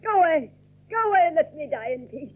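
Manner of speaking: put-on an old woman ordering her cousin out; bitter dismissal. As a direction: send him away, worn out and bitter. Hepzibah drives Geoffrey away.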